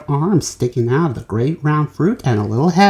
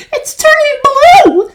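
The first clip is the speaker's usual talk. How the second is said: high, loud slow scream; horrified mother screaming; 10 dB louder, slower than usual. A narrator's reading. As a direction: scream it in horror. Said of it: "screamed Missus Beauregard".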